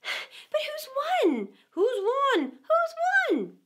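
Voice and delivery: very high, clamouring questions, breathy at the start; pant, then repeat it with mounting loudness; panting, asking. A narrator's reading. Winded racers crowding the Dodo, excited clamour. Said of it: "panting and asking".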